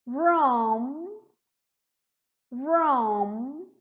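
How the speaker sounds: "Rom" ending on a long hummed M; closed final M. Shown as a demonstration. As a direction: hold the lips shut on the M of "Rom". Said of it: "M final, som fechado". ("Rom" com M final fechado)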